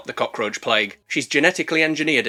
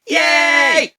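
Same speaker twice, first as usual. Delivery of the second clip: high, loud hammed-up cheer; mock cheer; 11 semitones higher, 5 dB louder than usual. Celebrating that no more children will die, mock cheer. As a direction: cheer with put-on glee.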